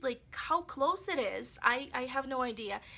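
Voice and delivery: ordinary talk, nothing marked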